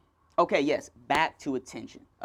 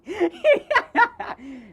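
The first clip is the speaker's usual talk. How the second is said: high laugh; 7 dB louder than usual. Real, not acted. Laughing at own story.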